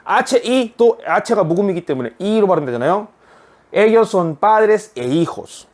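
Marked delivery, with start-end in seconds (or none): 3.7-5.7 very slow, word by word; slow reading. Shown as a demonstration. Teacher reading the sentence slowly to students. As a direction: read it slowly for learners. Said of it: "두 번째 문장도 읽어보겠습니다".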